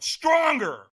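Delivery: high, forceful shouted order; retold trainer's scream. Trainer pushing firewalker to a peak state, shouting. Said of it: "So he screamed at me again".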